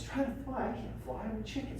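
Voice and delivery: soft, quiet objection; protesting chicken-eagle. Acted. Eagle refusing the coach, quiet and doubtful. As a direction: say it softly, sure you are only a chicken. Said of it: "They have different voices".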